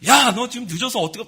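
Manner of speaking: loud, high-pitched telling-off; exasperated parent's outburst, retold; acted angry scolding. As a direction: scold the latecomer, re-enacting it. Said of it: "소리를 버럭 지르고".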